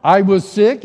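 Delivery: loud, high, stunned question; can't believe what he's hearing; surprised disbelief. Retold or imitated. Learns why she was angry.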